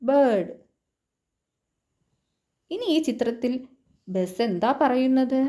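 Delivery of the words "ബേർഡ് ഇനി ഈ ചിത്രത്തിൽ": ordinary talk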